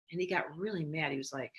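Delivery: ordinary talk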